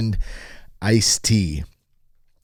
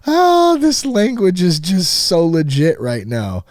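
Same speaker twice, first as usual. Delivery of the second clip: mock awe; 7 semitones higher, 3 dB louder, slower than usual. Mocking the article's wording.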